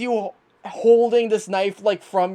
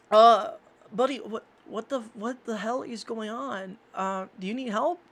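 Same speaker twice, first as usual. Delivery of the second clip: hesitant concerned Reed; 5 dB quieter than usual. Acted. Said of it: "you hear Reed goes".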